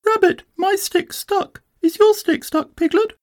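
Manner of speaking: very high-pitched little Roo voice; little Roo, pestering. A narrator's reading. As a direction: ask eagerly as tiny Roo. Roo keeps asking about sticks.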